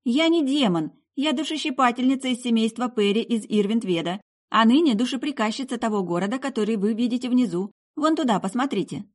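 put-on high-pitched girl explaining herself; puzzled young woman, pitched high; bewildered girl. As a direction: explain in a lifted, girlish voice. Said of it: "ответила девушка недоумённо".

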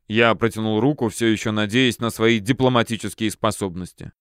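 ordinary delivery

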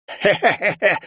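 high, dry undead laugh; creepy cackle. Acted. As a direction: cackle like a mocking skeleton. Skeleton laughs at hero.

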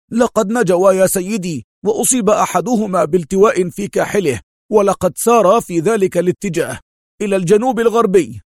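high, loudish, certain report; certain Dimal. A narrator's reading. Tracker reporting to his boss, high and certain. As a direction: report with no room for doubt. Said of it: "في لهجة لا تحتمل الشك".

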